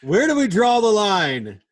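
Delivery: high, loud announcer-like call; mock-dramatic question. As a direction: pose it big and theatrical.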